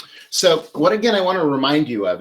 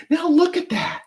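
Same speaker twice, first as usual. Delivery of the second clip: much higher and a bit louder, thrilled; delighted; 4 dB louder than usual. Real, not acted. Presenting the finished bouquet to viewers, high thrilled exclaim. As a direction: show it off with a high burst of delight.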